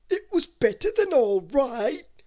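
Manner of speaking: high, loud, slow enthusiastic cry; cried. A narrator's reading. Mister Greedy, thanking the French chef, crying out happily. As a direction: gush with delight. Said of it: "cried Mister Greedy".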